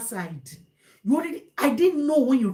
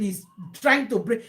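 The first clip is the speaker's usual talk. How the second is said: normal talking voice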